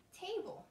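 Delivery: TAB-le, first syllable stressed, falling; model word. Shown as a demonstration. Therapist models the word for the child, clear and stressed. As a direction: say "table" with a strong first syllable. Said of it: "I'm gonna say a word".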